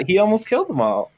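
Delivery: put-on gushing Valley Girl accent with stretched vowels. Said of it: "Because he talked a bit like this".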